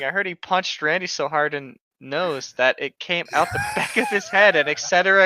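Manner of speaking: ordinary talk, nothing marked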